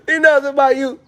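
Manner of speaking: "Me know say, my youth" in high, loud Jamaican patois; Jamaican accent. Pretend. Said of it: "when I go to Jamaica".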